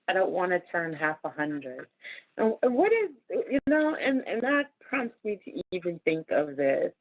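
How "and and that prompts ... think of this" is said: ordinary delivery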